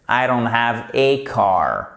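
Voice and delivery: slow "I don't have a car" with "a" picked out; corrected English example. Shown as a demonstration. Teacher correcting the double negative for learners, slow stressed correction. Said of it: "vous avez une double négation en fait".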